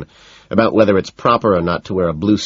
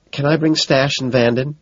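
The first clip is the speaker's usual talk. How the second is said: coy and higher; a touch of coy girlishness; 8 semitones higher than usual. A narrator's reading. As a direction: ask it coyly, teasing. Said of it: "she asks coyly".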